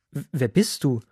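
stumbling, bewildered question; confused stammer. A narrator's reading. Blind old father asks his visitor who he is, confused and stammering. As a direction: ask haltingly, not knowing who stands there. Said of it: "Isaak fragte verwirrt".

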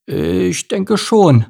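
hesitant, stretched-out grumble; grumbled reply. A narrator's reading. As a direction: grumble it slowly, dragging the words. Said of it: "brummte Mike".

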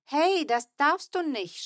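high, slow outraged shout. A narrator's reading. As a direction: shout at the brother's cheek. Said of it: "schrie ich".

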